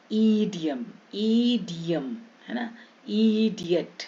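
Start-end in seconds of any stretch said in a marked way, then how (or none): 0.1-2.2 "ईडियम" with the first ई drawn out; long ee. Shown as a demonstration. Teacher showing i as big ee, stretched vowel. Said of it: "बड़ा बड़ा उच्चारण करेंगे". 3.1-4.0 "इडियट" opening on a drawn-out ई; long ee. Shown as a demonstration. Teacher showing i as big ee, stretched vowel. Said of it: "आई का बड़ी ई में".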